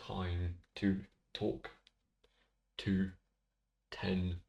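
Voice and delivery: each T word spoken slowly, alone; slow list of T words, one by one. Shown as a demonstration. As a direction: stretch out every word starting with T. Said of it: "I'm running out of t".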